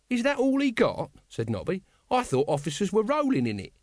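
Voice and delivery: high-pitched comic guardsman; incredulous Nobby. A narrator's reading. Guardsman hearing of the captain's meagre pay, incredulous. Nobby, figure in narrated story.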